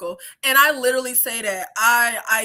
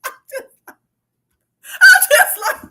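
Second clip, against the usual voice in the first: high, squeaky laugh spilling into words; can't get it out for laughing; breaking into laughter; 13 semitones higher, 9 dB louder than usual. Real, not acted. Cracks up at the memory.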